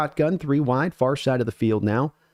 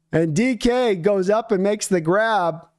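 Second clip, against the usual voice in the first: excited; 6 semitones higher than usual. Real, not acted. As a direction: call the big catch, fired up. Big catch on live play.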